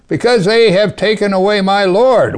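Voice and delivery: loud, high desperate cry of loss; anguished cry. Acted. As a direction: cry it out as if your Lord were stolen. Mary's anguished cry, preacher acting.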